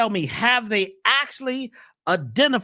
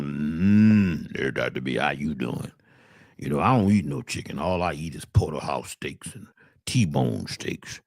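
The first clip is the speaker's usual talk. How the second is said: much lower, quiet, slow uncle voice; uncle's deep voice; 3 dB quieter, slower than usual. Acted. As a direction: rumble low and slow like a cow of a man. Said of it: "My uncle gets on the phone".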